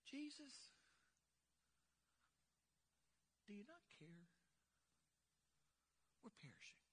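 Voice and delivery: whispered, very soft and limp; mock meek whisper. Disciples asking Jesus, in a mock meek whisper. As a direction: murmur it as if politely bored. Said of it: "I don't think that's the way they s".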